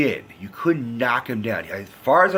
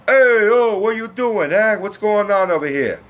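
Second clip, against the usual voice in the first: much higher, much louder New York Italian yell; loud angry Italian American; 10 semitones higher, 6 dB louder than usual. Retold or imitated. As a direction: bark it like a hot-headed New York Italian guy. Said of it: "That type of loud, angry as fuck Italian Americans".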